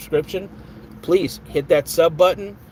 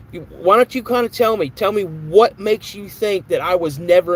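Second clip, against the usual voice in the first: ticked off; 4 semitones higher than usual. Real, not acted. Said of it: "I don't normally get ticked off real quick".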